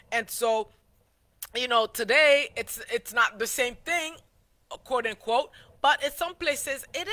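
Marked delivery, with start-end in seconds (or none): none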